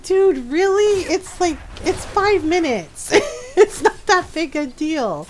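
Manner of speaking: high, slow mock exasperation; exasperated. Owner arguing with cat over late dinner, exasperated. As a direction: drag it out in pleading disbelief.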